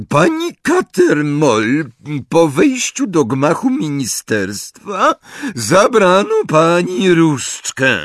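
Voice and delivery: high, loud, drawn-out official voice. A narrator's reading. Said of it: "Ambridge podniosła głos".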